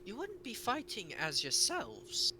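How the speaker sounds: a tiny king slowly savouring the idea; child king voice. Acted. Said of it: "voice of a small child".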